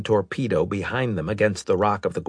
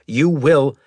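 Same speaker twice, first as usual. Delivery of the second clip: loud, raised command, cut off; barked order; 6 semitones higher, 6 dB louder than usual. A narrator's reading. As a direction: bark it at a junior officer. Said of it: "Maddox barked".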